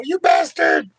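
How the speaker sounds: very high mock-outraged squeal; cartoon outcry. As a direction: throw in the squeaky cartoon accusation.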